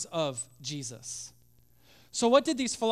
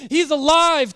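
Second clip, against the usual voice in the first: much louder, higher, thrilled cry; excited exclamation; 7 semitones higher, 12 dB louder than usual. Acted. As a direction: call it out overjoyed. Disciples tell Thomas the news.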